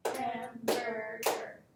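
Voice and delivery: Hamburger slowed, ham-bur-ger, beat by beat; clapped out syllables. Shown as a demonstration. Teacher slowly paces out Hamburger for the class. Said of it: "These words are easily clapped out".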